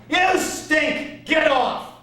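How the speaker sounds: high shouting heckle; heckler yell. Retold or imitated. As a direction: yell it from the back. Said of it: "a voice yells out".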